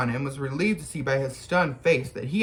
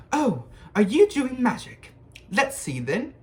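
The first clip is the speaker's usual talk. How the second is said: put-on much higher bossy girl voice; 9 semitones higher, 3 dB louder than usual. A narrator's reading. Bossy girl asking the boys about a lost toad. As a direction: bustle in like you own the place. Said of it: "She had a bossy sort of voice".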